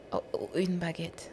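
hushed, slow, faltering echo; meek repetition. Retold or imitated. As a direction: repeat it small and soft after a scolding. Meek reply after angry correction.

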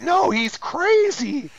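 high, drawn-out mock panic; mock frantic protest. Woman protesting to her dad about her date, in mock-frantic high voice. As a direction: play her protesting, high-pitched and stretched out.

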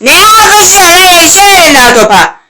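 high-pitched complaining shout; exasperated yelling. Real, not acted. Complaining about slow player.

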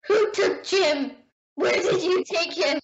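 high, drawn-out wail of alarm; hammed-up tearful demand; mock distress. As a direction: plead in exaggerated panic over a stolen friend. Mock distress at his British accent.

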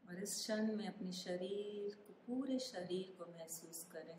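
calm meditation guide, unhurried with long pauses; guided meditation. Acted. Meditation guide asking listeners to feel their body, calm guided meditation. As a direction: ease the listener through a body scan, phrase by phrase.

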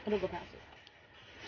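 breathy unvoiced whisper. Real, not acted. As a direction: whisper it under your breath. Aside while lighting up.

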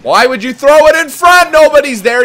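loud, high-pitched scolding shout; exasperated yelling. Real, not acted. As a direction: yell at a teammate in exasperation. Berating teammate for bad pass.

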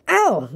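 storybook pained "ow", pitched far up; cried "ow". A narrator's reading. Pooh being pulled by Rabbit, high pained yelp. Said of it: "cried Pooh".

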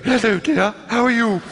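put-on high, breathy-sounding elderly English lady voice; speech teacher greeting a young boy in a strange voice; strange old lady voice. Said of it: "it was that sort of strange voice".

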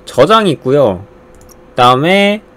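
ordinary talk

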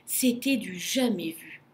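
usual speaking voice

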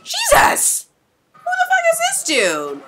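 very high, loud shriek of alarm; startled shout. Real, not acted. Viewer startled by a strange dude on screen, high startled shout. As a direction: yelp it out in shock.

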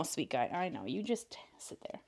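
whisper to cat. Real, not acted. Soothing the cat.

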